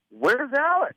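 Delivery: high-pitched mock demand; storming boss. Radio boss storms in looking for a colleague, high mock-irate demand. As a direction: play the irate boss hunting someone down. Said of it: "he's storming down the hall".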